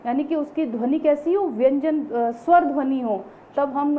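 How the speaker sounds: usual speaking voice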